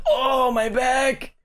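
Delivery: high, slow pained moan; mock groaning patient. The patient complaining about his back in a mock groan. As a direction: moan it like your back just gave out. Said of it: "he's just like".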